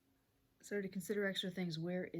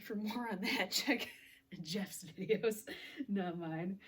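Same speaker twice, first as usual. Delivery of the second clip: joking aside broken by laughter; laughing; 3 semitones higher, 5 dB louder, slower than usual. Real, not acted. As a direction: laugh through the words. Jokes about someone else's videos.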